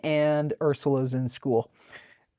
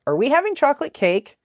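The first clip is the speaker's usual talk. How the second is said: put-on high eager child's question; little girl begging for cake; 12 semitones higher, 5 dB louder, faster than usual. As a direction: ask like a hopeful little kid. Child Ursula asks eagerly.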